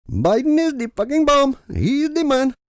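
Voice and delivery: put-on loud high Middle Eastern accented cheer. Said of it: "with a Middle Eastern accent".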